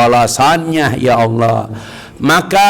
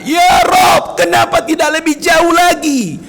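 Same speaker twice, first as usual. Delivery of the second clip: crying out to God; 13 semitones higher than usual. Retold or imitated. Pious man crying out to God after death. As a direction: call out to God in longing. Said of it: "dia masih berkata".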